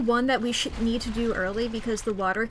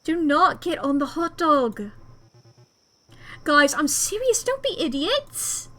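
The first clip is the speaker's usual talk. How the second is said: slow, emphatic acted scolding; raised-voice warning, voice climbing at the end; stern warning; 4 semitones higher, 3 dB louder than usual. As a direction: plead firmly with friends to behave. Voicing character scolding friends.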